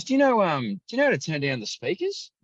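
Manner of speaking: put-on high, polite old bloke asking a favour; a fussy older spectator politely asking the commentator, in a high mock voice; older gentleman. Older gentleman complaining about speakers.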